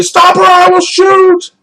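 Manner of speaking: slow, high, loud warning yell; mock armed shout. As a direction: bellow the warning over the gun. Bystander pulling gun, shouting.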